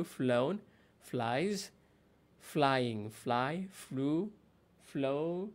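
ordinary talk

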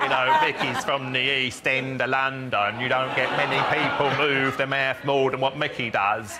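hammed-up Cockney with huge mouth movement; Mickey Flanagan. Linguist impersonating an East End comedian, broad slow Cockney. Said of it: "Mickey Flanagan".

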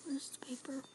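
whispering. Real, not acted. Hiding from monster.

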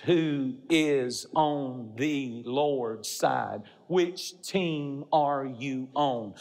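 very slow, word by word; solemn challenge. Real, not acted. Preacher puts Moses' question to the congregation, slow challenge. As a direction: weigh every word of the question.

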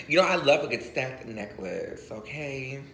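normal talking voice

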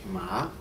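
"ma" starting low and sliding up; rising second tone. Shown as a demonstration. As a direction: raise the pitch through "ma", like Mandarin second tone. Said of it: "raise the pitch of your voice".

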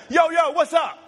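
high, shouted, insistent call; emphatic greeting. Shown as a demonstration. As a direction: call someone over urgently. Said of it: "No, we gotta talk now".

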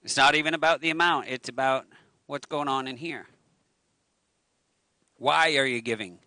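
ordinary delivery

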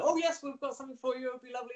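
high-pitched, hurried mock enthusiasm; gushing quoted reply. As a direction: imitate an obliging yes, quick and high. Acting out the casting team's eager emails.

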